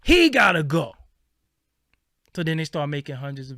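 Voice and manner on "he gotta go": loud, emphatic mock decree; mock declaration. Mocking online critics who want him gone, loud and emphatic. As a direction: proclaim it mockingly.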